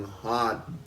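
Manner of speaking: normal talking voice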